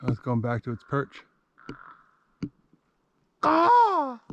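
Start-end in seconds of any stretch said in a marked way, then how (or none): 3.4-4.2 loud, high caw; crow call. Shown as a demonstration. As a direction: answer the crow with one caw. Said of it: "crow call worked".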